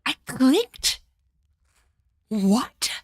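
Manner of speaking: loud, high incredulous outrage; real exasperated outburst; outraged disbelief. Real, not acted. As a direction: yell at the useless character. Outraged at character's blunder.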